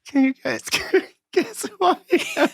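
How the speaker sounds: words broken by giggles; can barely get it out for laughing; laughing through words. Real, not acted. Laughing through his own question.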